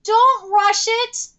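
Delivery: slow, high-pitched plea; pleading. Real, not acted. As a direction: stretch each word in urgent appeal. Begging the studio not to rush.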